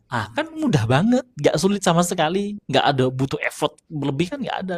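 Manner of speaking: ordinary talk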